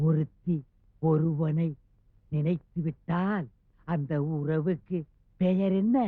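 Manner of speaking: word by word with long gaps; slow, broken phrases. Acted. Husband prompting his unanswering wife, piece by piece and slowly. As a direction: spell the question out piece by piece.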